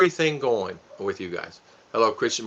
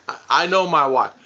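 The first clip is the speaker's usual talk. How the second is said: usual speaking voice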